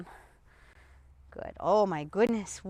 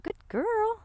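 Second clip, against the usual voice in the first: high, cooing praise; praising aside to dog; 11 semitones higher, 4 dB quieter than usual. Real, not acted. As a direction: break off to coo high at the dog. Aside praising the dog mid-explanation.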